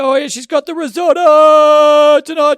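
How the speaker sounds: loud, high, slow announcer voice; mock MC commentary. Joining the joke about the hype man at home, mock MC call. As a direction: call dinner like a game announcer.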